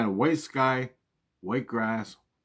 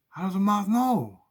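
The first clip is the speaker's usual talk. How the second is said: incredulous demand; 9 semitones higher, 4 dB louder than usual. Retold or imitated. Arguing with mom.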